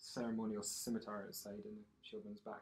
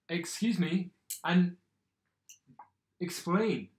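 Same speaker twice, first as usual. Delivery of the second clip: loud, raised demand; calling out; 6 semitones higher, 10 dB louder, slower than usual. Acted. Minister demanding an explanation in the square, calling out. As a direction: project it firmly over a crowd. Minister addresses a silent crowd.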